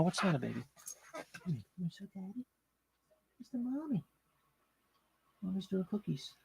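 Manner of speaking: hushed, high doggy talk to his pet. Real, not acted. Talking to the dog softly.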